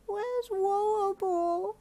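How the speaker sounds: put-on high, slow, urgent little girl; anxious toddler pleading. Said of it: "Koemi asked urgently".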